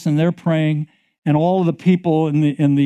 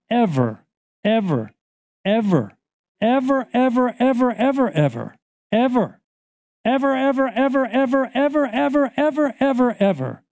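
higher and higher repeated ever; building insistence; 7 semitones higher than usual. Acted. Preacher warning the congregation, ever rising high. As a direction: chant ever rising in pitch.

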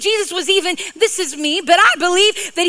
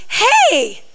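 excited shout; 8 semitones higher, slower than usual. Retold or imitated. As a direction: point at the dead tree in wonder. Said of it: "He goes".

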